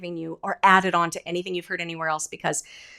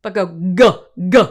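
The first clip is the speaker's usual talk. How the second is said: louder punchy g bursts; powerful g; 4 semitones lower, 8 dB louder than usual. Shown as a demonstration. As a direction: hit the g with full power. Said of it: "You are a power machine".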